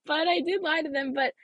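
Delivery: laugh, then a high-pitched admission; giggly, sheepish owning up to a lie; laughing confession. Real, not acted.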